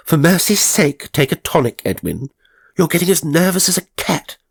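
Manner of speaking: high, loud, irritable exclamation; irritable sister. A narrator's reading. Spinster scolding her nervous brother, high and irritable. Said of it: "she exclaimed irritably".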